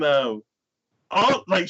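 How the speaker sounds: normal talking voice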